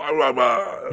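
hammed-up growling caricature; Batman. Shown as a demonstration. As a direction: push the vigilante voice into a mangled roar. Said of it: "And then it just goes on".

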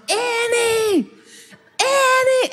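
high, drawn-out mock scream for a lost child; retold scream. Parent calling a lost child through the store, high retold scream. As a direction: re-enact a parent's frantic high yell. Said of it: "now I'm screaming at the top of my lungs".

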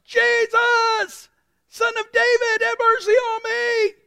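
loud, high-pitched cry for help; belted, drawn-out plea over a crowd; shouting. Acted. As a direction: shout it out at full volume, pleading. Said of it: "he began to shout".

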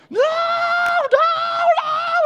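high, piercing shriek of a child in mock terror; screaming five-year-old. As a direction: scream like you're being killed in the shower. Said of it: "He screamed like I was killing him".

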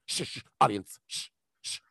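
low, hissed mock shushing; host shushing. Game show host to excited audience, mock shushing. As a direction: hush the crowd as an imitated host.

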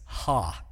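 "ha" as one light laughing syllable; Greek ha. Shown as a demonstration. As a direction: say "ha" short and breathy like a laugh. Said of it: "it sounds like this".

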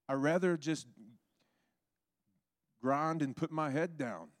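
high, reluctant excuse-making; protesting complaint. Retold or imitated. Preacher retelling his old excuses to God, complaining.

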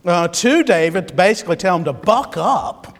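usual speaking voice

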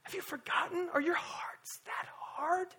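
hushed, slow, searching whisper. Real, not acted. Preacher to church, sudden intimate whisper. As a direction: lean in and whisper the question.

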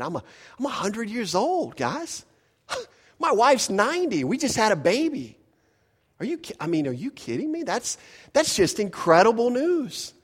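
high, giddy disbelief with a laugh; amazed Abraham. Retold or imitated. Pastor voices Abraham about his baby, thrilled. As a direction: gush like a stunned new father. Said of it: "He was look, saying".

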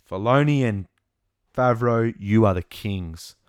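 cheering the creators like a fan; loud cheer. Acted. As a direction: hail them like heroes.

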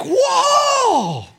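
high, loud amazed shout; amazed whoa. Retold or imitated. Pastor reenacting his amazement at the students, loud high whoa. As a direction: let the wonder burst out. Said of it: "And I'm like".

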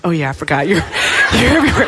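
amused, breaking into laughter; sheepish realisation through laughter; laughing. Retold or imitated. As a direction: let a laugh break up the words. Laughing at her own silly question.

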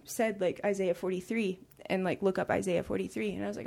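normal talking voice

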